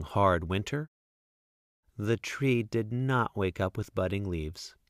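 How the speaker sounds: plain reading voice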